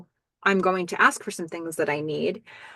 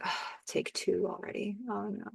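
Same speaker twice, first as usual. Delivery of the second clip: mock groan; 14 dB quieter than usual. Billy groaning at retake.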